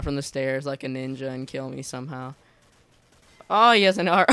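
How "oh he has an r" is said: high loud burst into laughter; surprised laugh. Real, not acted. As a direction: caught off guard, laughing out. Surprised by enemy weapon.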